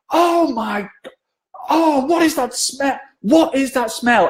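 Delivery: high, slow disgusted gasp; disgusted newsreader. Retold or imitated. Veronica recoiling from the cologne, high and slow. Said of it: "she literally is like".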